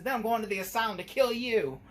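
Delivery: imitating a pissed-off killer doll; angry Chucky. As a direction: fume it out like a furious doll. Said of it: "He said".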